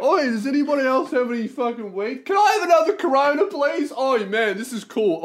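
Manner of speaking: high, hammed-up Kiwi accent; mock loudmouth Kiwi, pitched well up; Kiwi guy. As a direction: imitate an excitable New Zealander calling out. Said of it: "this other Kiwi guy is like".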